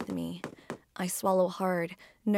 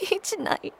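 sobbing; 10 semitones higher, 8 dB louder than usual. Acted. Breaking down over the emptiness.

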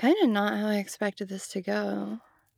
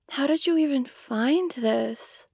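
ordinary talk

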